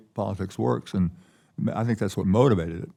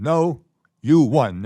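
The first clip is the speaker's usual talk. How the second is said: raised, louder retold retort; emphatic rebuttal; 5 semitones higher, 8 dB louder, much slower than usual. A justice rebuts the clerk over a tie game, emphatic. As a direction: contradict firmly, each part set apart by a pause.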